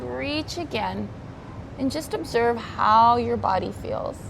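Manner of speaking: calm, unhurried yoga teacher cues; soothing voice leading a stretch; guided yoga instruction. Acted. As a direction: guide the class gently through each stretch.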